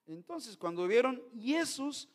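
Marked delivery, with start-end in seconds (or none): 1.4-2.1 "Jesús" as "Iesus", with a Y sound; higher, demonstrative Greek "Jesús", ee-EH-soos; Greek pronunciation. Shown as a demonstration. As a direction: say "Jesús" with an "ie" onset, no J. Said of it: "nosotros no decimos Jesús".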